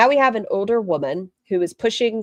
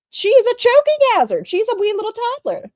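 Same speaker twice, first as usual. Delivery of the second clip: very high-pitched, hammed-up outcry; loud at first, staying very high; mock alarm; 5 dB louder than usual. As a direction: sound the alarm in an exaggerated high squeal. Mock alarm at chain in mouth.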